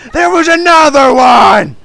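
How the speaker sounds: slow, high, very loud yell; exasperated scream. Real, not acted. Player finding another extinguisher too late, drawn-out scream. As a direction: drag it out at full volume. Discovers another one, yells.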